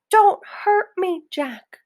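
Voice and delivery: high, loud begging giant; a giant pleads with Jack in a nightmare; pleading yell. A narrator's reading. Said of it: "he yelled".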